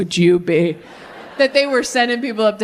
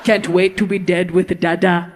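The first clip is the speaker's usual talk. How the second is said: creepy churchgoer; 4 semitones lower than usual. Retold or imitated. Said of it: "That's church".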